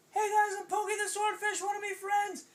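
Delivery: high, loud cartoon greeting; big shouted hello from a cartoon fish; eager swordfish. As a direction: call out cheerily in a high voice. Swordfish greets turtles in story.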